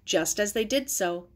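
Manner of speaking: ordinary delivery, nothing marked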